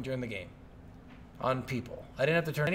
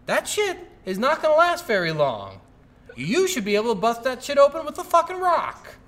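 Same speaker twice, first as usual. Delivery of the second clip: loud, high-pitched incredulous protest; exasperated rant; 8 semitones higher, 6 dB louder than usual. Acted. Player arguing with the game's locked-door logic, exasperated. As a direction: argue it hotly, voice pushed up high.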